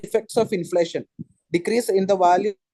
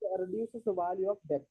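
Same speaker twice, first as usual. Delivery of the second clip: faint, far from the mic; barely audible; 6 dB quieter than usual. Real, not acted. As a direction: voice fading away from the mic. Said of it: "why your voice is very low".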